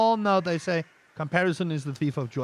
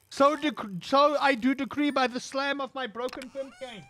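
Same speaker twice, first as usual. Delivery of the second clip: higher, pompous proclamation as a pretend king; higher hammed-up king's decree; mock royal decree; 6 semitones higher than usual. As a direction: proclaim it like a joke monarch banging his cane. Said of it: "if I had run the kingdom".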